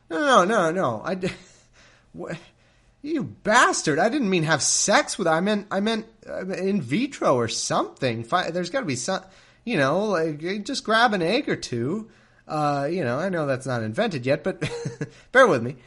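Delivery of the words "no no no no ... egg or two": high, loud exasperated outburst; outraged Sarai. Retold or imitated. Sarai scolding Abram for misunderstanding, outraged and loud. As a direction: blow up at him in disbelief.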